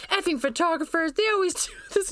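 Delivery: high mock-angry read of the text. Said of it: "like, missed was like".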